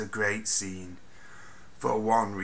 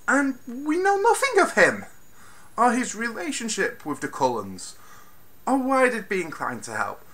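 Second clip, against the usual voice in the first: exasperated rant; 11 semitones higher than usual. Real, not acted. Ranting about wasted characters.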